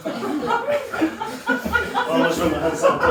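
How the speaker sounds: slow, high, laughing through the words. Real, not acted. Comic laughing at his own joke. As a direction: crack up mid-sentence.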